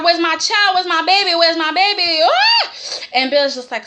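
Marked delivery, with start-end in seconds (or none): 0.0-2.7 high, rising panic ending in a shriek; mock panic. Imitating a mother hunting for her baby, hammed-up panic. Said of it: "So Katie is panicking".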